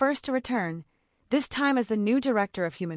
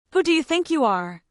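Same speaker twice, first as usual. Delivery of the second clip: louder, higher angry spit of words; spat out; 6 semitones higher, 5 dB louder than usual. A narrator's reading. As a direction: spit the question at her in rage. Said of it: "he spat".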